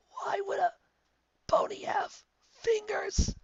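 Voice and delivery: high strained croak, then a whispered end. Acted. Twilight croaking a question at Lyra, strained. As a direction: squeeze it out in disbelief. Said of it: "Twilight croaked".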